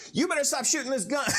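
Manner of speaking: high, quick mock small-guy voice; mock little guy. Little ref taking on a big football player, mock high-pitched demand. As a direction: squeak out a hurried demand like a tiny man. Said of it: "Josh was a little bit of a little dude".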